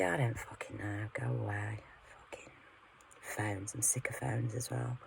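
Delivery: fed-up whisper under the breath; soft unvoiced grumble; whispered aside. Real, not acted. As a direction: hiss it off to the side, not for the camera. Aside to someone, annoyed at phones.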